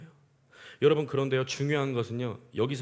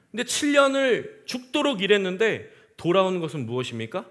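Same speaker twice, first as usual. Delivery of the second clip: normal talking voice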